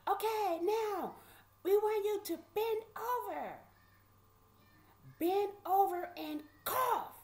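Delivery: loud mimicked instruction, landing hard on cough; imitated demand. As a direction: announce the order like a stern sheriff. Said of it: "the demand was".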